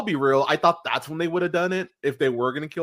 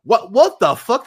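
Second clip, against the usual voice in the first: loud, higher stunned outburst; shocked disbelief; 9 semitones higher, 9 dB louder than usual. Real, not acted. Host learning the writers made Deck the Halls, shocked. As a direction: react as if it can't be true.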